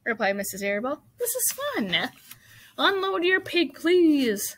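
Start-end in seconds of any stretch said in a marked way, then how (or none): 1.2-2.1 very high, chirpy delight; cheery encouragement. A narrator's reading. Character cheers up nervous friend. 2.8-4.6 high, loud call to the crowd; loudspeaker announcer. A narrator's reading. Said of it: "said the loudspeaker".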